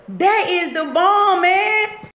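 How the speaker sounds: high, drawn-out delight; excited praise. Real, not acted. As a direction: cheer them on, stretching the words. Praising a viewer's forgiveness news.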